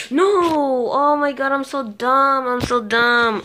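high loud self-scolding; frustrated yell. Real, not acted. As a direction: berate yourself out loud.

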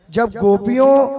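ordinary talk, nothing marked

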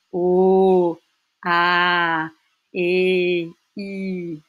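"O, A, E, I" each held long and steady; long vowels. Shown as a demonstration. Teacher demonstrating Dutch long sounds, each vowel drawn out. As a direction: stretch every vowel, "O, A, E, I", pausing between. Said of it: "Ça se prononce vraiment long".

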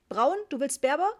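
loud, high, pushy questions; impatient. A narrator's reading. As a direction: press the reluctant customer hard. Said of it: "fragte der junge Mann ungeduldig". (ungeduldiger, lauter Verkäufer)

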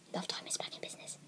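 hushed whispered announcement. Real, not acted. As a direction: whisper it under your breath. Whispered aside announcing series return.

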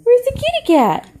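put-on high sing-song toddler question; reading to a toddler. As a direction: lift it way up, singsong. Repeated higher for toddler.

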